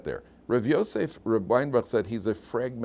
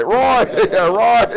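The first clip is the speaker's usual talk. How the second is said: high, booming holler, breaking into laughs; calling out; 14 dB louder, much slower than usual. Retold or imitated. Trucker summons the other truckers, loud laughing holler. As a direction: yell for the whole crew. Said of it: "he called on the other trucker".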